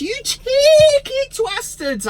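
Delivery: squeaky high mock outrage; high-pitched cheeky jibe. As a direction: squeal it like a cartoon insult. Mock outrage at Italian clubs.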